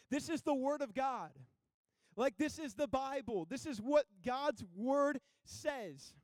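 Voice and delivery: loud, high preaching with drawn-out key words; impassioned preaching. Acted. Preacher urging the church that they need Him, impassioned. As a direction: preach it with full force and weighty pauses.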